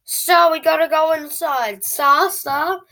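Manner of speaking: high, loud urgent call to the dog. Retold or imitated. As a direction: hurry the dog back inside.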